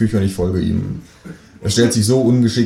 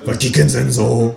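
neighbour voice; 4 semitones higher than usual. Acted. Nachbarin fragt.

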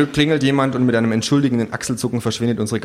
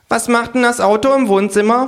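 much higher, loud nosy creature voice; kangaroo; 13 semitones higher, 5 dB louder than usual. Acted. The kangaroo needles the hostess, high and cheeky. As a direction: poke at it with cheek.

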